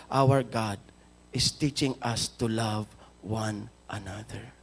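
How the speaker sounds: hushed loving reassurance to an angry man; tender, loving. Retold or imitated. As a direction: gently tell the man you won't fight back. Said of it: "with full of love".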